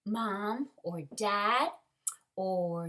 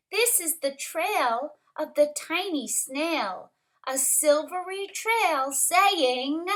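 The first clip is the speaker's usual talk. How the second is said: lively rhyming picture-book reading, lifted stresses; story narration; 6 semitones higher, 6 dB louder than usual. A narrator's reading. Teacher reads rhyming story to kindergarteners, lively narration. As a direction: punch the key word of each phrase.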